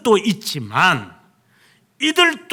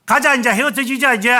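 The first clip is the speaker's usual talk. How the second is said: acted call to leave; 6 semitones higher, 4 dB louder than usual. Disciples calling to disband.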